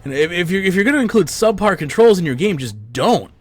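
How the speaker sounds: high, heated complaining; angry rant. Real, not acted. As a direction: keep venting, exasperated. Continued rant at developers.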